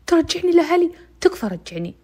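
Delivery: high, loud pleading question; begging to be returned home; loud anxious plea; desperate plea. Retold or imitated. Girl pleading with captor.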